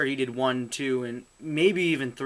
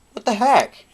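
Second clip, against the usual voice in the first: higher, louder shocked cry; 9 semitones higher, 10 dB louder than usual. Real, not acted. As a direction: blurt it in shock.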